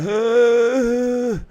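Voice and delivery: high, loud mock wail; hammed-up crying moan, like a beaten fighter; imitating a cry. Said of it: "like he crying".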